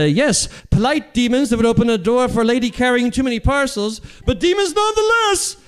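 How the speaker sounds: high, put-on comic declamation; hammed-up indignation. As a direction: climb to a very high mock-dramatic finish. Acted comic comeback to friends.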